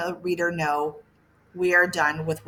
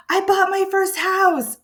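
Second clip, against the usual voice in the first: much higher, thrilled exclamation; excited; 12 semitones higher, 4 dB louder than usual. Shown as a demonstration. Teacher showing learners an exclamation point, excited and high. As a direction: burst out with happy news, voice leaping up. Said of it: "if you're excited about something".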